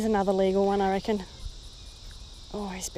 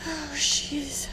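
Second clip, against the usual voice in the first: whispered exclamation; 4 semitones higher, 4 dB quieter than usual. Real, not acted. Quiet exclamation over fish.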